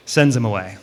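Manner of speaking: ordinary delivery, nothing marked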